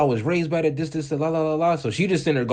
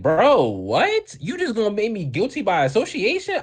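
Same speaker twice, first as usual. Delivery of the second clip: indignant protest; 8 semitones higher than usual. Retold or imitated. Protesting to teacher.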